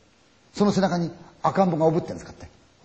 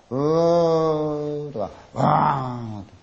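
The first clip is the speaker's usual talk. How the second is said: slow drawn-out moans; mock moaning; 2 semitones higher, much slower than usual. Storyteller imitating car-like moaning sounds, slow drawn-out moan. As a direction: stretch each moan like a far wail.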